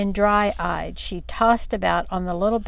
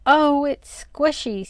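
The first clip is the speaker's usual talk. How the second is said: slow, high, breathy sob; sobbing; 7 semitones higher, 4 dB louder, slower than usual. A narrator's reading. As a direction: whimper it out in disgust. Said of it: "she sobbed".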